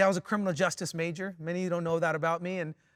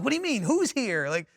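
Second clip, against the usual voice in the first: high-pitched mock bewilderment; waking-up confusion; 8 semitones higher, 4 dB louder than usual. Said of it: "I'm, like, waking up".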